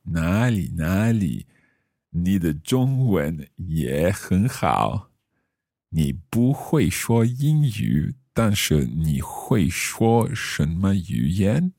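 slow, careful learner Mandarin; careful Chinese accent. Acted. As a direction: speak each syllable slowly, role-playing. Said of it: "my best Chinese accent".

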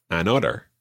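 American "otter", double T as a flick; American accent. Shown as a demonstration. Teacher shows the double T sound, American accent. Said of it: "the double T sound in there".

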